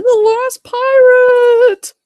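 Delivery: high, loud wail with long held vowels; Henry. Acted. Henry in his train spotting the pirate, loud drawn-out cry. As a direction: cry out in exaggerated alarm. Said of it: "that one with Henry in his train saying".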